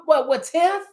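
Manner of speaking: ordinary delivery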